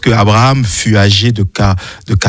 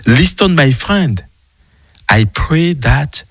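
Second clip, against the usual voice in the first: slow, careful English with a French accent; effortful French-accented English; 5 semitones higher, slower than usual. Real, not acted. Reading a Bible inscription aloud to the host, in effortful French-accented English. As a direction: read English haltingly through a French accent. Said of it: "excuse-moi mon anglais".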